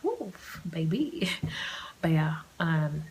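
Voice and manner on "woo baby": whispered woo. Pretend. Voicing the sun kissing her.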